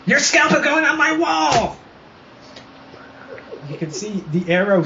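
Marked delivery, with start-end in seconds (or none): 0.1-1.8 villain shout. Acted. GM voices enemy threat.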